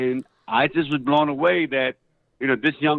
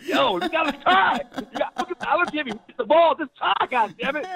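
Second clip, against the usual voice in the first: high, fast mock coaching rant; a dad imitates himself pushing his daughter to try, high and hammed-up; pushy soccer dad; 13 semitones higher than usual. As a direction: egg her on like an overbearing dad. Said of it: "I was that kind of dad".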